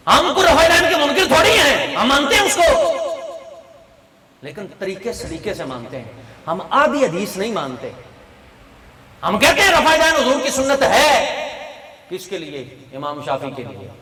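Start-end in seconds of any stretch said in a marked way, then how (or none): 0.1-2.9 high, loud preaching protest; shouted rebuttal. Real, not acted. 9.2-11.3 high, loud preaching; forceful declaration. Real, not acted.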